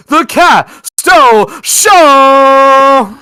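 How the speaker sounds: long, booming, stretched-out shout; ring announcer shout. Acted. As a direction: bellow the show name to the crowd. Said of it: "the wrestling intro bit".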